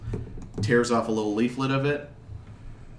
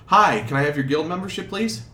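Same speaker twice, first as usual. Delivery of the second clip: polite clerk; 6 semitones higher, 4 dB louder than usual. Acted. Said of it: "somebody turns to you and says".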